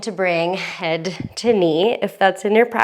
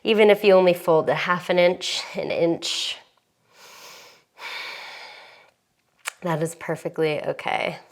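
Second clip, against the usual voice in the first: calm yoga instructor. Acted.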